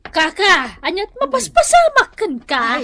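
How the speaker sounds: alarmed cry. Acted. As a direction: cry out in fear for her son. Old woman alarmed by bad news. (napigsa nga ikkis ti buteng)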